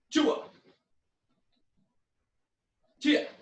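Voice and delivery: high shouted kiai; karate yells. Shown as a demonstration. Teacher yelling on front kicks. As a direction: yell with each kick. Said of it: "Kicking with your back leg".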